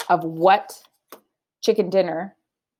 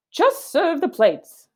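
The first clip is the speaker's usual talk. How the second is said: impatient Missus Rogers; 9 semitones higher, slower than usual. Acted. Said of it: "said Missus Rogers".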